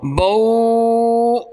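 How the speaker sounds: बऊ with two vowels, ba then u; recited model. Shown as a demonstration. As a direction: say बऊ as ba-u, not bau. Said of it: "क्या पढ़ेंगे?". (बऊ को बौ नहीं, ब-ऊ पढ़ें)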